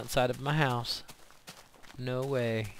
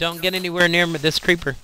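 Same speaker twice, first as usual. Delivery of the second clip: loud urgent warning; alarmed shout; 4 semitones higher, 10 dB louder than usual. Real, not acted. Warning about the creeper.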